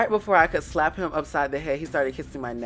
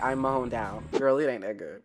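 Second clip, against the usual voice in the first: normal talking voice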